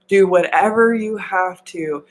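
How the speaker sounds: normal talking voice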